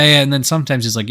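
normal talking voice

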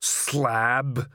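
drawn-out troll growl; one grim word from a troll. A narrator's reading. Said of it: "he growled".

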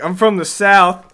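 slow, loud teasing imitation of a friend; Grant Keller. Teasing co-host as a proud Southerner, mock boast. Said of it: "Grant Keller over here".

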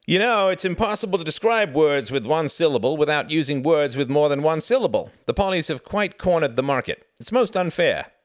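high, loud, voluble character voice; hearty, teasing Frederick. A narrator's reading. Frederick banters with terse Reginald, high and hearty.

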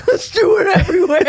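high, loud laughing squeal; laughing quote. Retold or imitated. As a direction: cackle it out through laughter. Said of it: "she was like".

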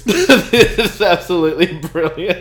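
laughing. Real, not acted. Laughing at the blunder.

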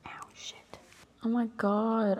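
ordinary talk

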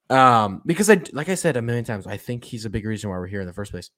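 ordinary talk, nothing marked

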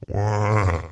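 loud mock newborn wail; baby cry. Shown as a demonstration. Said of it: "my cry sounded something like this".

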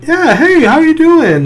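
imitated high, friendly hello; high-pitched polite greeting. Retelling a comedian greeting a German guy, high-pitched.

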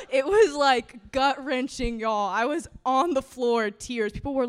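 telling the crowd while still laughing; laughing through it. Real, not acted. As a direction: push the words out through laughter. Laughing while recalling pain.

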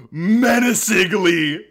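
very high, loud, slow menacingly; slow loud high-pitched menacingly; menacing drawl. Retold or imitated. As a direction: stretch the word out dramatically as Patrick. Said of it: "so iconic".

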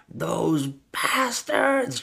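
high, drawn-out hammed-up wail; slow, high-pitched mock outrage; mock freak-out. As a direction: imitate an overacted meltdown, stretching each word. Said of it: "she starts freaking out".

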